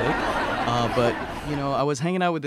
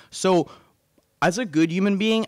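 usual speaking voice

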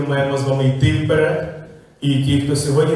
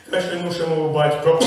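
normal talking voice

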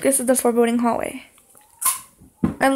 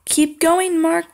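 ordinary delivery

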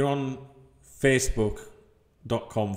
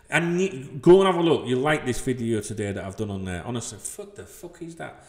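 ordinary talk, nothing marked

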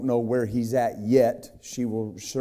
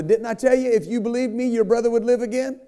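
mock Jesus rebuke; 12 semitones higher, 5 dB louder, faster than usual. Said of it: "He told Martha".

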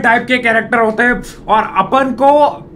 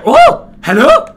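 surprised hello; 14 semitones higher, 6 dB louder, much slower than usual. Real, not acted. Surprised at a character.

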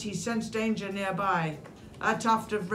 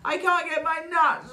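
high, louder tearful wail; storybook crying complaint, pitched up; 9 semitones higher, 5 dB louder than usual. A narrator's reading. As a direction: wail it like an upset little squirrel. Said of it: "Squirrel cried with a wail".

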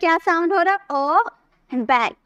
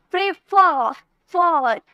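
Prefer with फॉर hit a bit harder; prefer pushed on the final फॉर; stress on last syllable; slower than usual. Shown as a demonstration. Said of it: "एकदम जोर देकर बोल रहे".

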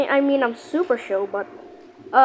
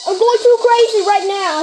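higher, louder, stress on too; louder redo; 6 semitones higher, 4 dB louder than usual. Shown as a demonstration. Gamer redoing his line, higher and louder. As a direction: punch it out with energy this time. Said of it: "I have to do it again".

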